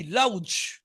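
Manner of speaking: লাউজ, slow, with the g read as a j sound; drawn-out লাউজ ending in a j sound; spelling pronunciation. Shown as a demonstration. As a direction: misread laugh letter by letter as লাউজ. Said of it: "এরকম কিছু একটা হবে".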